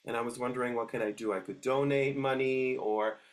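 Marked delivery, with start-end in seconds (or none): none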